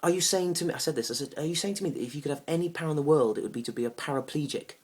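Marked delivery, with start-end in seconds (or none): 0.0-0.7 loud, high-pitched indignation; incredulous challenge. Acted. Man challenging his date's answer, indignant. Acted argument with date. 1.4-4.7 loud, raised-pitch outrage; incredulous challenge. Acted. Man challenging his date's superpower choice, indignant. Acted argument with date.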